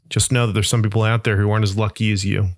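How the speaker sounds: ordinary talk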